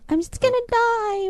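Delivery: high-pitched melodramatic whine; mock crying wife. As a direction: imitate a wailing drama queen. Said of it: "blah, blah, and cry".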